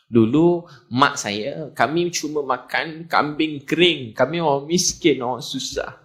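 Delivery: slow, measured words to calm the overawed; humble reassurance. Acted. The Prophet to trembling companions, slow humble reassurance. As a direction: take your time, plainly and gently humble.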